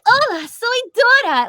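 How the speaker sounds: very high cartoon girl voice; high-pitched greeting in a cartoon character voice; Dora. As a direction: chirp it high like a children's cartoon host. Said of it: "and then Dora".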